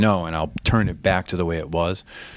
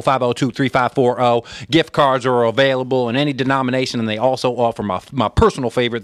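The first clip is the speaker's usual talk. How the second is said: fast-patter Southern auctioneer ad read; Southern auctioneer twang; 3 semitones higher, 3 dB louder than usual. Acted. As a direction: rattle off the ad in a raised Southern twang. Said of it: "as an auctioneer voice".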